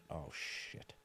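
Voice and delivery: whispered dread. Retold or imitated. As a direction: breathe it out in fear. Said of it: "sitting there going".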